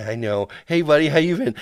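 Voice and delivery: high, jittery nervous puppet voice. Retold or imitated. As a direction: play a jumpy, nervous guy. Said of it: "I was doing the nervous voice".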